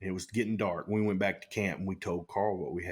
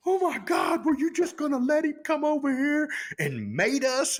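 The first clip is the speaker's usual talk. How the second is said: much higher, louder mock outrage; alarmed shout; 4 dB louder than usual. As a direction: shout it in disbelief. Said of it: "And R C goes".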